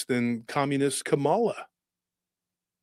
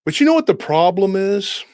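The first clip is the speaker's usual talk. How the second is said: usual speaking voice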